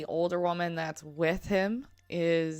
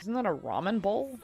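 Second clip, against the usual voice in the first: ordinary delivery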